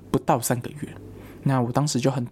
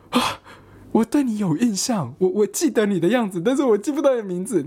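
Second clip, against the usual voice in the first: excited recall; 8 semitones higher than usual. Retold or imitated. Meeting old coworker, surprised recognition.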